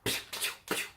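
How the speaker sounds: soft whistly imitated "piou" sounds; small, silly repeated chirp; annoying "piou" noise. Shown as a demonstration. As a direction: squeak out little unvoiced pious. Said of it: "vous voyez ce bruit très agaçant".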